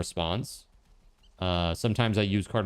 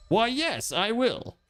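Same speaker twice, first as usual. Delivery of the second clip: high, prim, mock-polite answer; posh mock reply; 10 semitones higher, slower than usual. Answering game prompt playfully.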